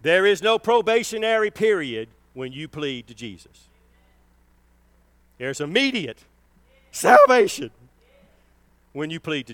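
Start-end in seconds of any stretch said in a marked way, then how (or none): 0.0-7.7 preaching at full force. Acted. Preacher promising the congregation immediate salvation, building to a shout. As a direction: drive it home and peak on salvation.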